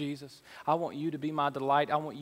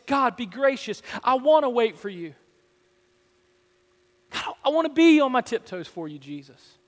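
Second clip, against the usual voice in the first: higher, louder, pleading prayer; passionate cry; 6 semitones higher, 8 dB louder than usual. Shown as a demonstration. Pastor showing the church how to pray boldly, impassioned. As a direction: cry out to God with longing. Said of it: "Or say it like this".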